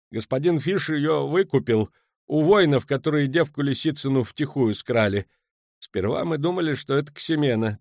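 apologetic, high-voiced account; nervous merchant confessing. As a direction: explain yourself uneasily, piece by piece.

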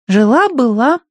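put-on high, loud child voice; little girl voice. A narrator's reading. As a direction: speak like a demanding little girl. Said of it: "подсказала Агнес".

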